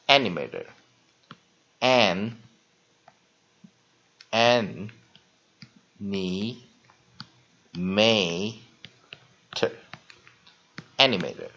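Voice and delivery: "animator" drawn out very slowly, split as "an-" then whole, with a long "may" vowel; teacher modeling "animator" very slowly; syllable-by-syllable demo. Shown as a demonstration. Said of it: "所以把这个拉成长母音啊".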